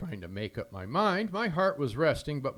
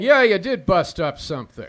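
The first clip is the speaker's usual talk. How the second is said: annoyed; 6 semitones higher, 12 dB louder than usual. Acted. Phone quarrel with Walt.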